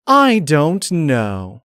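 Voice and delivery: sinister and deliberate; cold menacing glare. Acted. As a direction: drag each word out like a threat. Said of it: "never speaking that way".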